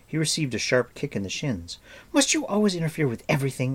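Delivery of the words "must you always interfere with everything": indignant. Acted. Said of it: "Eilonwy asked indignantly".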